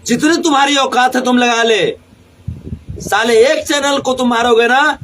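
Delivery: ordinary talk, nothing marked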